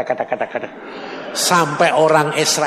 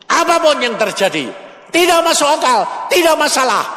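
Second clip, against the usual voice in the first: preaching at full force; 8 semitones higher than usual. Real, not acted. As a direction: drive the point home to the congregation. Preaching climax.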